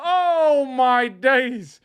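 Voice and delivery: sudden high, loud cry of disbelief; excited exclaim. Real, not acted. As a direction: gasp it out at the wild shot. Sudden wild shot in match.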